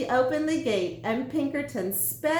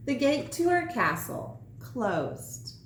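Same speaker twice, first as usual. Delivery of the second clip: ordinary delivery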